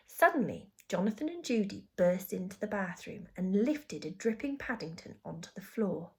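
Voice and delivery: ordinary delivery, nothing marked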